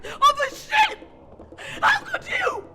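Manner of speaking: shouting in betrayed fury, voice cracking; wronged wife yelling through tears; furious shout. Acted. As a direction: scream the accusation at him. Shouting accusations at sister.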